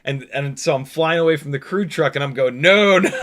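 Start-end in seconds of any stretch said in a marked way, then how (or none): 2.6-3.1 mock refusal. Acting out refusal while flying away.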